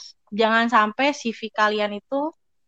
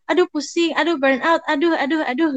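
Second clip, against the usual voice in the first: high-pitched string of little moans; mock fretting; 6 semitones higher than usual. Telling friends about burnout moments, mock fretting. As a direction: playfully whine through the aduhs. Acts out own burnout moaning.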